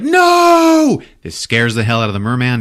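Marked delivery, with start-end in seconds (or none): 0.0-1.0 much higher, louder cry of horror; horrified shout. Retold or imitated. Jenkins finds his headless cat, horrified shout. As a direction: scream no at the sight of the dead cat. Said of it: "Jenkins is like".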